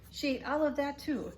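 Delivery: gentle read-aloud for little listeners; storybook reading. A narrator's reading. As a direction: read it like bedtime story time. Reading picture book to kids.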